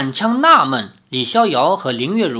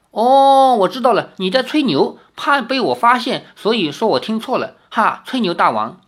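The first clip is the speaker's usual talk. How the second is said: deliberate teasing; 6 semitones higher, 4 dB louder than usual. Acted. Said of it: "李逍遥故意说".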